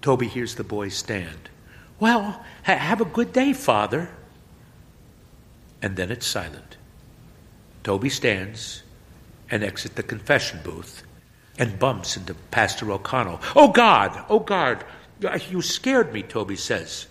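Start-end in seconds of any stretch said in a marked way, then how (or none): 2.0-4.1 put-on high, bright teen goodbye; cheerful farewell. Boy happily saying goodbye to the priest, high. 13.6-16.2 loud, high startled yelp; startled cry. Acted. Toby bumping into the pastor, startled and loud.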